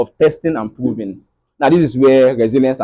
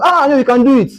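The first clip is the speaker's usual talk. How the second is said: mock encouragement; 11 semitones higher, 3 dB louder than usual. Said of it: "People will tell you".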